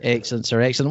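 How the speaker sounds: ordinary talk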